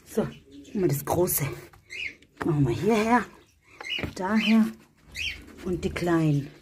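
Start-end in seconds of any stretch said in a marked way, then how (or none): none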